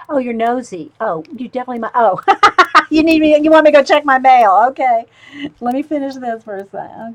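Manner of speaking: high and loud, teasing the nosy pet; talking to a pet. Real, not acted.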